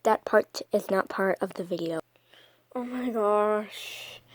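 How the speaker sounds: whispered aside. Real, not acted. Aside to viewers about the video, whispered. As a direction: breathe it, no voice. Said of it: "aside whispered".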